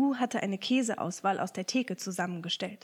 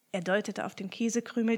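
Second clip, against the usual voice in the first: ordinary delivery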